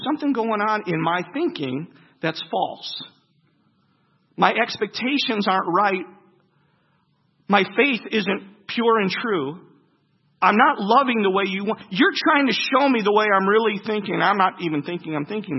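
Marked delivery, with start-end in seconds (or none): 4.4-15.6 earnest confession. Acted. Confessing preaching, louder.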